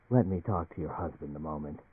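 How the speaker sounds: soft, quiet and gentle; a hushed, gentle request to a colleague's husband; peculiarly gentle. A narrator's reading. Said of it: "in a peculiarly gentle voice".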